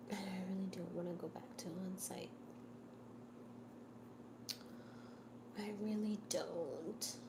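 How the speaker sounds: soft, confiding whisper. Real, not acted. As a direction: whisper it like a secret. Confiding whisper about on site.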